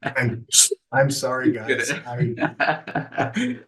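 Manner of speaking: fighting back tears, apologizing; choked up after crying. Real, not acted. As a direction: voice high and tight with tears. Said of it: "I am not at a loss for words".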